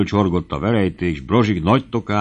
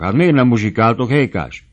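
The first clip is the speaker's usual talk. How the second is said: raised, indignant question; higher and louder, taken aback; startled protest; 4 semitones higher, 5 dB louder than usual. A narrator's reading. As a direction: call out to the idle band. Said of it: "riadtak föl a táncosok".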